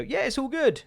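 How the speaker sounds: high, chirpy retailer reply; lightly voiced cheery shop clerk; a hint of breezy customer service; mock retailer voice. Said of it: "waiting for them to kinda go".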